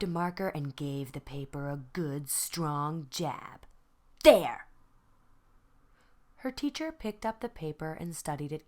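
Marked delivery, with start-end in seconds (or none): 4.2-4.7 loud triumphant jab of a word; defiant shout. Acted. Girl defiantly finishing her mark, shouting loudly. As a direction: slam it down like the marker. Vashti jabs the paper.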